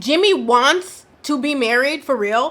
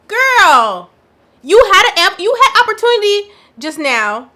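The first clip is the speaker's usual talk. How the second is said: exasperated scold; 8 semitones higher, 7 dB louder than usual. Real, not acted. Scolding Chelsea on the screen.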